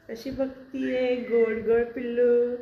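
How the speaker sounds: high, slow cooing. Real, not acted. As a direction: coo over a puppy. Cooing to dog. (लाडिक आवाज)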